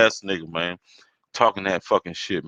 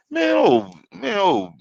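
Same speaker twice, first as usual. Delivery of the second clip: high exasperated groan; exasperated cry; 11 semitones higher, slower than usual. Real, not acted. Reacting to being called out, exasperated high cry. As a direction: throw it up in disbelief.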